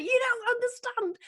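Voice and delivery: high, laughing plea; laughing protest. Retold or imitated. Telling the friends about the growler, laughing and high. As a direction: squeal it through laughter. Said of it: "and I'm like".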